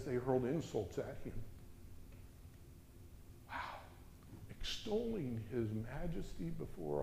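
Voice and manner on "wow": soft breathed Wow; hushed wonder; awed hush. Real, not acted. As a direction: marvel quietly.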